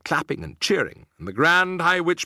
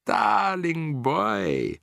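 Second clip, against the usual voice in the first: very slow, higher, sweet witch voice; cooing; 6 semitones higher, much slower than usual. A narrator's reading. Grand High Witch luring Bruno, sugary cooing. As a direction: coo like a false granny. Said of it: "cooed the Grand High Witch".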